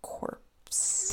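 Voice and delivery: whispered corpse, breathy and seductive; failed seductive. Shown as a demonstration. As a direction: try to make corpse sound sexy. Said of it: "Was that sexy?".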